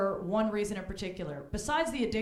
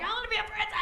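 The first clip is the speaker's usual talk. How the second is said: put-on bratty little girl; 13 semitones higher, much faster than usual. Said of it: "they're all like".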